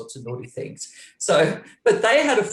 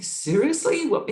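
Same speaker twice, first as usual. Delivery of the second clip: disbelief; 12 semitones higher than usual. Retold or imitated. Iggy says meet someone.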